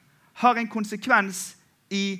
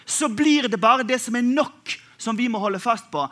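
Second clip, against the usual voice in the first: loud, lifted preaching over music; full-force preaching; 4 semitones higher, 5 dB louder than usual. Acted. Pastor preaching to the church over music, in full force. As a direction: pour it out to the congregation.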